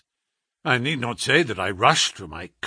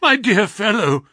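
louder, higher anxious call; crying out; 8 semitones higher, 7 dB louder than usual. A narrator's reading. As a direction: cry out to a sick friend. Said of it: "I cried".

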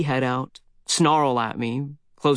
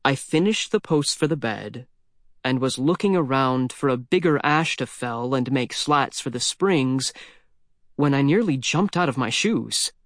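usual speaking voice

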